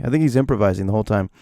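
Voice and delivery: usual speaking voice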